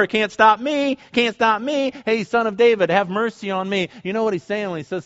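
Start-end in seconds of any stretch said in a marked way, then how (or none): 0.0-3.9 higher, slightly raised retold cry; insistent crying out. Blind man calling to Jesus over the crowd, insistent crying out. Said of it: "he cries out all the more".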